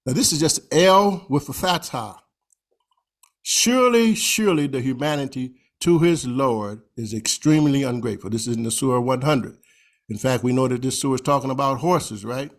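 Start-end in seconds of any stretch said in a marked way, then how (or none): 3.4-8.3 emphatic start then settle; emphatic surely. Shown as a demonstration. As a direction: punch the opening surely. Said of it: "let you know I'm emphasizing".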